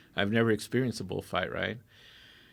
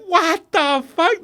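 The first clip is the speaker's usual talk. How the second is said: very high, loud, slow shocked cry; stunned shout; 11 dB louder, much slower than usual. Retold or imitated. Bystanders seeing sewage everywhere, slow shocked yell. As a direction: drag out the disbelief at full volume.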